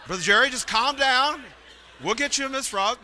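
high-pitched, lightly acted plea to settle down; mock calming. Camp host calming a worried guest preacher, mock high-pitched. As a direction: pitch it way up, playfully talking someone down.

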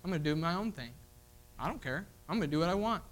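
quiet, dismissive brush-off; a sulky teen waving off a parent. Retold or imitated. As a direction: shrug off the warning with a scoff. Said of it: "Sometimes their response is".

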